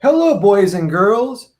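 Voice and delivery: drawn-out, singsong imitation of a greeting; imitated slow, cheery hail; drummer's hearty greeting. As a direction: stretch the hello like a showman greeting everyone. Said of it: "he goes".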